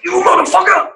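high, loud mock shouting of threats; hammed-up screaming caller on an answering machine; imitated raging voicemail. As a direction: play the furious caller screaming down the phone. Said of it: "It's like".

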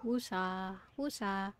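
soft, drawn-out mellow chant; calming. Retold or imitated. Streamer imitating a biology teacher who calmed herself, calming. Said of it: "she had, like, a very calming voice".

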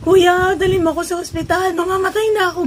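high, loud urging of the driver; urgent plea. Retold or imitated. To the tricycle driver, loud urgent plea. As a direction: hurry him like you're dying. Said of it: "Sabi ko".

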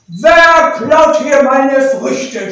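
loud, slow, roaring demand; angry leopard shout. A narrator's reading. Leopard catching thieves in his grove, slow furious shout. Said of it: "schrie der Leopard".

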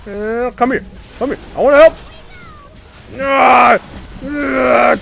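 loud, high frantic commands; battle shouting. Real, not acted. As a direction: bark it mid-fight. Frantic team fight.

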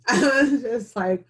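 laughing through the memory; rueful laugh at herself. Real, not acted. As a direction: laugh as you remember breaking down. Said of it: "I couldn't do it".

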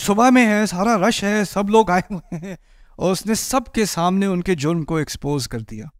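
normal talking voice

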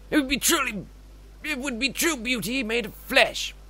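high, lilting, rapturous plea; theatrical pleading character. Acted. Game character begging the player to dive, rapturous. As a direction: implore with flowery grandeur.

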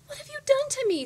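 very high, loud squeal. A narrator's reading. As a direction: squeal in horror like a transformed princess. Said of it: "squealed the princess".

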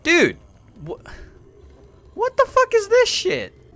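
very high, loud disbelieving cry; outraged disbelief. Real, not acted. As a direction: shriek in disbelief. Outraged at game scene.

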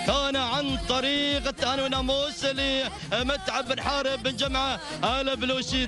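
usual speaking voice

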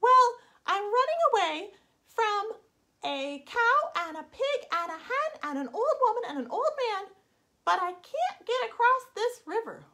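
high, anxious little voice; worried gingerbread man. Acted. Stranded gingerbread man fretting at the river, high and anxious. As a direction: fret about the river.

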